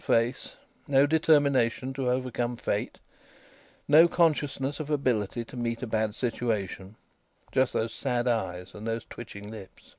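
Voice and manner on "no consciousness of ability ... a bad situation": ordinary delivery, nothing marked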